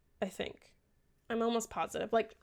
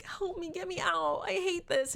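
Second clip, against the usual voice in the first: mock pleading; 8 semitones higher than usual. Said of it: "and she's like".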